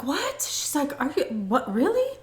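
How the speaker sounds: high squeaky disbelief; mother stunned at the news; shocked mom. Retold or imitated.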